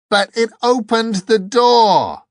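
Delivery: very high, loud, drawn-out scream. A narrator's reading. Angel screaming at Dor about the opened door, outraged. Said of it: "screamed the angel".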